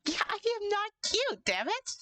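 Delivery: high, squeaky protest; cute voice. Real, not acted. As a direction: squeak out the denial. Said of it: "Is the T-Dragon voice cute".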